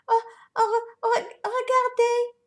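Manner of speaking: put-on baby bear stuttering at the sight. A narrator's reading. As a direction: stammer with excitement. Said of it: "dit Bébé Ours en bégayant".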